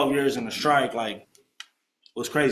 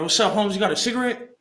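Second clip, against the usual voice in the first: higher-pitched street greeting, acted out; cocky greeting; 6 semitones higher than usual. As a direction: retell hailing a stranger for a smoke. Acting out his cocky approach to a stranger.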